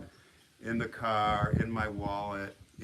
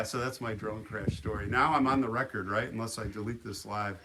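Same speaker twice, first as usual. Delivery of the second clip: ordinary delivery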